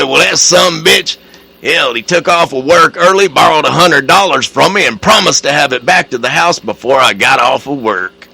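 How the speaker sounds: loud, high hammed-up rant; loud hearty husband. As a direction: belt out the punchline. Ted explaining the brother's loan.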